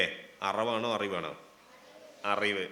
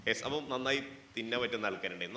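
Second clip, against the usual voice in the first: normal talking voice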